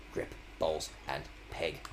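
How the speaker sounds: whispered to self. Real, not acted. Streamer repeating the game's advice, whispered. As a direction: whisper the steps like a mantra.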